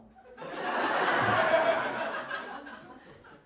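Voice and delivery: long airy laughter; loud breathy laugh. Real, not acted. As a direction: crack up loudly at the punchline. Laughs after punchline.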